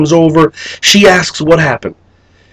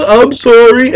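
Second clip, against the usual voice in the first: high, whimpering fake sobs; mock crying apology; 8 semitones higher, slower than usual. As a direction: pretend to blubber your way out of trouble.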